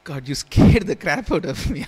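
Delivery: loud mock fright; startled outburst. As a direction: blurt it out in relief. Relieved outburst at the misunderstanding.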